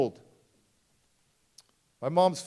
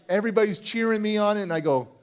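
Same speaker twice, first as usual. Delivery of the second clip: ordinary talk, nothing marked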